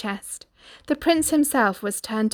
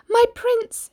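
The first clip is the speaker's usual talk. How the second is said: Cindy's inner voice; 6 dB louder than usual. Acted. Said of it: "she thought".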